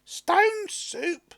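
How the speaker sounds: high, loud doubting woman; high and scoffing at the idea; woman's voice. A narrator's reading. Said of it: "the woman laughed".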